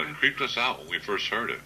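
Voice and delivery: usual speaking voice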